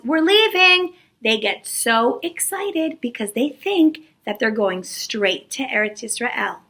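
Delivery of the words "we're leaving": higher-pitched, sing-out mock announcement; lightly voiced announcement. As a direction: lightly call it out as Moshe. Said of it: "And then Moshe says".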